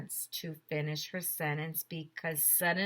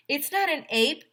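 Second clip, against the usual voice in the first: put-on louder and higher kid's yell; 9 semitones higher, 7 dB louder than usual. Andrea yells to the kids, a loud high shout. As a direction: shout it out to the whole crowd. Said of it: "yelled Andrea".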